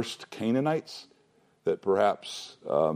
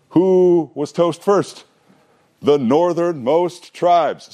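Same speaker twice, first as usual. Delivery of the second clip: higher, louder and very slow, every word weighted; slow punchline; 8 semitones higher, 5 dB louder, much slower than usual. Real, not acted. Teacher revealing the irony to his class, slow and emphatic.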